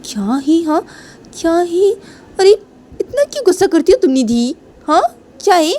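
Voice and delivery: high-pitched exasperated pleading; acted high whine to an angry partner; whiny protest. Girlfriend protesting in acted quarrel.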